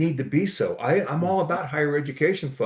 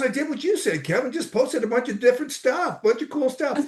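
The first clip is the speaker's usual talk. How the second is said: quoting the friend; 9 semitones higher than usual. Retold or imitated. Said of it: "He goes".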